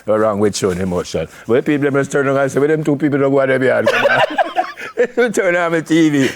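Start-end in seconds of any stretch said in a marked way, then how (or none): 1.5-6.3 fast, laughing retelling of gossiping viewers; laughing mock. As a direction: rattle it off while cracking up.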